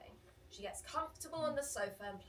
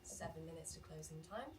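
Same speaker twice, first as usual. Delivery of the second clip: usual speaking voice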